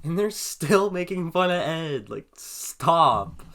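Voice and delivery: loud, stretched-out complaint, pitched high; over-the-top exasperated wail; exasperated outburst. Acted. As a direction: drag out the protest and belt the last word. Reader acts exasperation at fan teasing.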